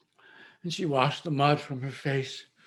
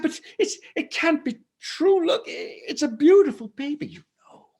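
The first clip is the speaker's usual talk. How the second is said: much higher, pleading disbelief; desperate denial; 11 semitones higher than usual. Acted. Mother begs the stranger to be wrong, pitched high. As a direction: plead that it cannot be true.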